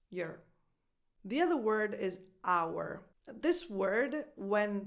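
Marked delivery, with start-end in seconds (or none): 0.1-0.4 "your" as a short, low-key "yer"; reduced your. Shown as a demonstration. Coach repeating the reduced word, reduced your. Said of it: "becomes a schwa".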